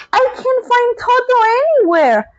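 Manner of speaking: put-on high young girl voice, worried; girlish Dorothy. Dorothy calling back to the wizard, high and worried. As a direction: fret like a small girl.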